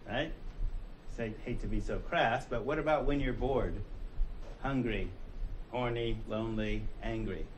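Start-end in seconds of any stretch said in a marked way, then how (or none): none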